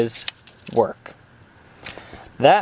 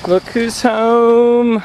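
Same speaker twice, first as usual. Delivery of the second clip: slow, drawn-out loud greeting; welcoming someone home; 6 semitones higher, 6 dB louder, much slower than usual. Real, not acted. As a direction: stretch it out, loud and happy.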